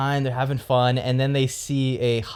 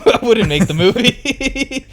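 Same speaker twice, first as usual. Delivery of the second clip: high, slow, cracking up mid-line; squeaky giggling retake; laughing through it; 11 semitones higher, 6 dB louder than usual. Real, not acted. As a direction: say it again while breaking into laughter. Restarts same line laughing, pitched up.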